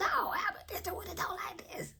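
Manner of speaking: very high, raspy cartoon squeal; high-pitched husky cartoon voice; Fat Albert character. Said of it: "of Fat Albert".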